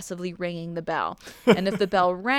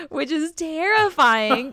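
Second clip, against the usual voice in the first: mock horror; 8 semitones higher, 6 dB louder, slower than usual.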